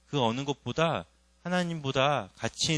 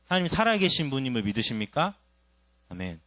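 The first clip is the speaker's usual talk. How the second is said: usual speaking voice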